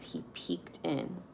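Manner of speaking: unvoiced slow whisper; hushed. A narrator's reading. Narrator whispers as the policeman peeks into the truck. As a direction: hush it like a secret.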